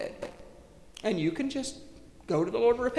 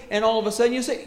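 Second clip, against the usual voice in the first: normal talking voice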